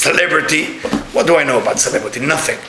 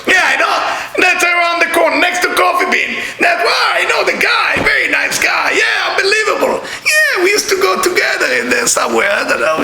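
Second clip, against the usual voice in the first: mock name-dropper; 13 semitones higher than usual. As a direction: ham up the show-off who knows everyone.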